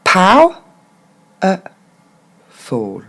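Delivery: "Pow-, e-, ful" in three spaced parts; syllable by syllable. Shown as a demonstration. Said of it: "maintenant pour le mot en entier".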